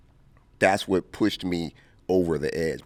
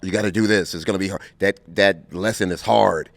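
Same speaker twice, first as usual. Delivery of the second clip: ordinary talk, nothing marked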